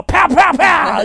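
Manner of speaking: high, loud shouted pow pow; mock gunshots. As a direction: fire finger-gun noises like a cowboy. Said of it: "make finger guns ... and go".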